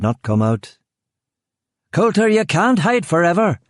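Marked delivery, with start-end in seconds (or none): 1.9-3.6 high, shouted threat; yelled. A narrator's reading. Killian threatening the hiding man, high shout. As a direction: yell it out across the garden. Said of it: "Killian yelled".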